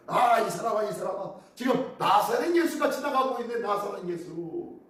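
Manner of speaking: ordinary talk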